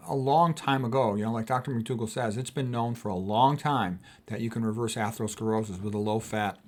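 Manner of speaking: normal talking voice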